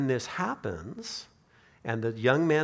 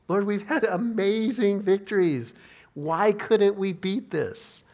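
higher, forceful appeal to the Lord; frustrated plea; 6 semitones higher, 4 dB louder, slower than usual. Acted. Disciples asking Jesus why they failed, frustrated plea. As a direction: plead in acted bafflement, louder at first and drawing out the question.

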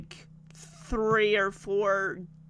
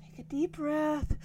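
softer, higher soothing talk; calming big brother; 4 semitones higher, 10 dB quieter than usual. Retold or imitated. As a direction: talk him down gently. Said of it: "Jackson gets over and he's just like".

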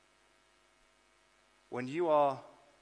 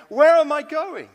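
high, loud panicked cry; mock panic; 12 semitones higher, 15 dB louder than usual. Preacher acting out a believer lost without God, mock panic. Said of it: "you're not panicking, going".

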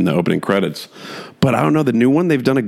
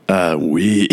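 mock French; 3 semitones lower than usual. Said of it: "not even attempting a French accent".

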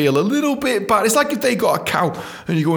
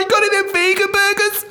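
much higher, pleading customer voice; squeamish customer. Retold or imitated. Put-off customer asking clown for vegan burgers, high pleading. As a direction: beg the clown for a vegan option. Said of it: "You'd be like".

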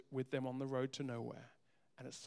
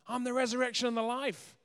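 ringing proclamation, much higher and louder; proclaiming; 9 semitones higher, 9 dB louder than usual. Acted. Jesus declaring truth to grieving Martha, ringing loud. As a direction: thunder it out like a revelation. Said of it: "Martha gets hit by truth".

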